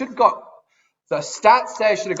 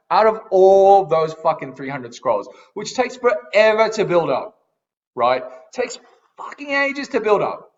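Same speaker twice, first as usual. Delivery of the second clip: ranting; 6 semitones higher, 5 dB louder than usual. Real, not acted. Ranting about scrolls.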